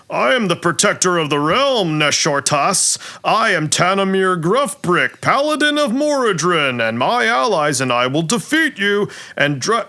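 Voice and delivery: high, declamatory paladin speech; heroic dwarf actor. Acted. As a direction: proclaim it like a hammy stage hero. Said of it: "dwarf, uh, actor says".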